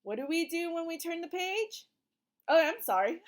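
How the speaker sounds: ordinary delivery, nothing marked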